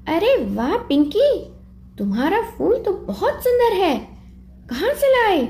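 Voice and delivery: put-on a touch of a young girl gushing over a flower; child Chinki.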